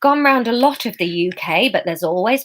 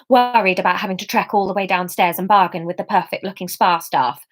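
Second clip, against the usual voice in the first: ordinary delivery, nothing marked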